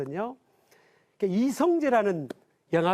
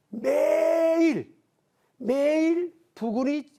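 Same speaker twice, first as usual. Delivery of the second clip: "매일" stretched very slowly, higher and louder; emphatic "매일"; 8 semitones higher, 5 dB louder, much slower than usual. Real, not acted. Preaching to the congregation, stretches "매일" slowly and loudly. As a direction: lean hard on each "매일".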